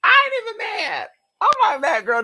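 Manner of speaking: high gushing voice. Real, not acted. Vlogger laughs over the candle's face, high playful squeal. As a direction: squeal that you're not mad at all.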